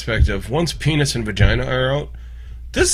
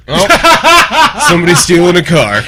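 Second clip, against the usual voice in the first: laughing mock alarm; 4 semitones higher, 8 dB louder than usual. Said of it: "every guy is gonna go".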